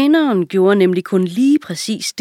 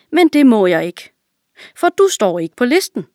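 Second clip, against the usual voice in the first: naive servant; 5 semitones higher, 5 dB louder than usual. A narrator's reading. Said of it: "sagde den dumme tjener".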